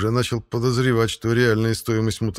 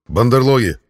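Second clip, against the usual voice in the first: shouting; 3 semitones higher, 7 dB louder than usual. Acted. Calling to attack.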